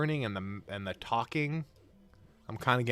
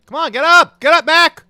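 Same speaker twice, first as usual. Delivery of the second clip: shouting; 20 dB louder than usual. Real, not acted. Cheering fallen boxer on.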